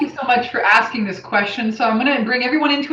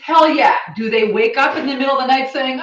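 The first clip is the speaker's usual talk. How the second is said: normal talking voice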